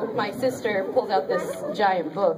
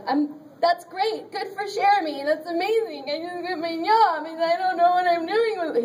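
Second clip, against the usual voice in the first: upset congratulations; 10 semitones higher than usual. Retold or imitated. Acts own upset congratulations on phone.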